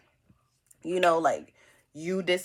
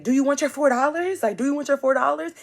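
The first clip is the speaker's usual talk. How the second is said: higher pushy repeated question; mock offering; 8 semitones higher, 3 dB louder than usual. Friend pushing cash at the roommate, higher pushy question. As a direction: press the offer with attitude.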